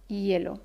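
"hielo" dicho "yelo"; H como Y. Shown as a demonstration. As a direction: haz sonar una ye al inicio de "hielo". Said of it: "se pronuncia con un sonido y". ("hielo" pronunciado "yelo")

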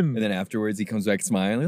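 ordinary delivery

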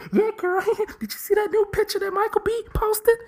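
put-on very high giggly girl talk; squealing laughter over a photo; giggling gossip. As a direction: sisters gossiping on the phone. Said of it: "talking about".

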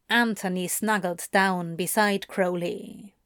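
usual speaking voice

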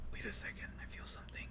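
barely audible, voiceless whisper; whispered quote. Retold or imitated. Kid telling the doctor he feels something, whispered. Retold sick kid speaking faintly.

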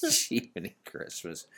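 ordinary talk, nothing marked

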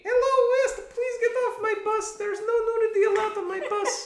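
high, loud woman voice. Retold or imitated. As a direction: squeak it in a falsetto lady voice. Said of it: "That's what women sound like to me".

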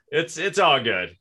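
ordinary talk, nothing marked

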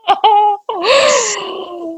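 long, high peal of laughter; real laugh. Real, not acted. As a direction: laugh helplessly at your own anecdote. Laughing at her own farting anecdote.

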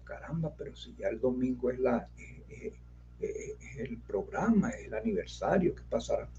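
quiet. Retold or imitated. Worried thoughts quoted to himself.

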